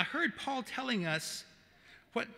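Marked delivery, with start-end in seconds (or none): none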